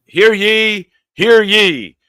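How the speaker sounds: loud, high proclamation; town crier. Pretend. Host announces fans like a crier, booming. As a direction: call it out like a town crier to the crowd.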